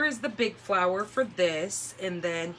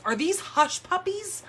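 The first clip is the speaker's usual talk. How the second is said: husband's puzzled question; 10 semitones higher than usual. Retold or imitated. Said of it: "He goes".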